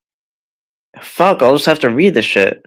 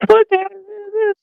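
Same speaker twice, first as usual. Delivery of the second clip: shocked. Real, not acted. Shocked by what he reads.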